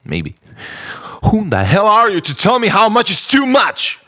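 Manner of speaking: ordinary delivery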